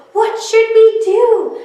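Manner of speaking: high, loud alarmed cry; children crying out. Acted. Children asking what to do, crying out high. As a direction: call out in a panic. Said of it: "The children cried out".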